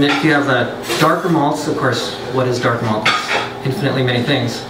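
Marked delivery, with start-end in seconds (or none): none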